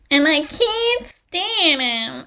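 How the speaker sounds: high, slow, nasal scratchy voice; nasal screechy starlet. Retold or imitated. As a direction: squeeze it through your nose like a shrill silent-film diva. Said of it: "it's just this nasal scratching voice".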